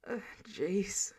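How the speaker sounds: Rhodey recovering from a fright, whispered; breathless whisper. Acted. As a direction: whisper it, hand on heart. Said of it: "Rhodey clutched his chest".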